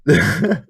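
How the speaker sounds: cracking up, can't stop; helpless laughter. Real, not acted. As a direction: lose it completely.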